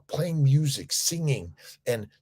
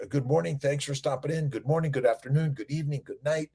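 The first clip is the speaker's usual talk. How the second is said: ordinary delivery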